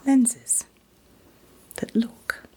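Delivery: normal talking voice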